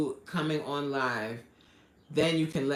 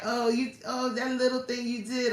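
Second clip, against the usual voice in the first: higher-pitched dismissive mimicry; mock belittling; 8 semitones higher than usual. Quoting jealous friends who don't celebrate you, mock belittling. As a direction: imitate someone playing down a win.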